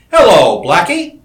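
loud, high hail across the room; calling out. A narrator's reading. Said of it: "he called".

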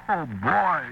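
put-on elated cry of "Oh, boy"; overjoyed oh boy. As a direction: burst out with relief and delight. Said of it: "I practically kissed the thing".

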